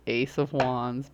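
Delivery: normal talking voice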